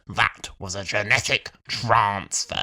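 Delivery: low, slow, drawn-out pronouncement; imitated Doctor. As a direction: draw it out low in mock gravity. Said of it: "And he's like".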